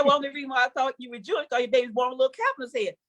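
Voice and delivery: fast doctor explanation; hurried doctor voice; rushed explanation. Retold or imitated. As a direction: rattle it off quickly.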